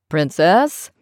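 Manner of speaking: lightly voiced father calling out from upstairs; a hint of a father calling loudly from upstairs; father calling his daughter from upstairs, mild boom; booming father's call. A narrator's reading. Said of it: "Dad's voice boomed".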